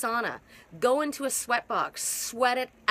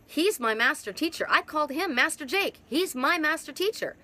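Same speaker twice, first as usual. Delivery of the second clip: normal talking voice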